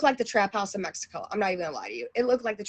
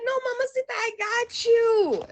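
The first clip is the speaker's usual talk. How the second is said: reassuring guy imitated; 11 semitones higher than usual. Local guy reassuring worried tourist, imitated in a high voice. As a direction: mimic his reassurance through leftover laughter.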